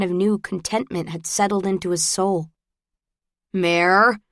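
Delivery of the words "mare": mild note of warning. A narrator's reading. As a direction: call the name as a caution. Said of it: "Shepley warned".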